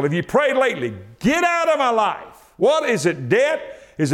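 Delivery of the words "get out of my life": high forceful command; commanding obstacle. Acted. Preacher ordering an obstacle away, high and forceful. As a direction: cast it away with authority.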